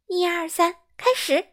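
playful start of a sleep race; counting off. Acted. Counting off a game.

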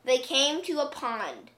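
usual speaking voice

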